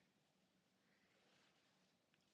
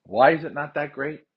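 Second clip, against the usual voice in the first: indignant, louder demand; 5 semitones higher, 6 dB louder than usual. Real, not acted. Angry venting about sales.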